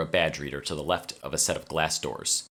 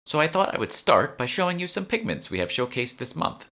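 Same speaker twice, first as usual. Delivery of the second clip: much higher, bright guide voice; bright curator; 11 semitones higher, 4 dB louder than usual. A narrator's reading. Curator Mona starting the tour, high bright voice. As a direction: welcome them like a keen guide.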